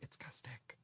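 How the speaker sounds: barely audible whisper; whispered correction. Retold or imitated. Retelling correcting people on surname, meek whisper. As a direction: correct them under your breath. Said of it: "and I'm just like".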